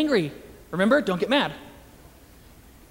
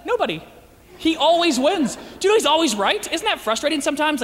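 ordinary talk, nothing marked

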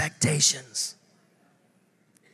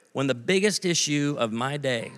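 ordinary talk, nothing marked

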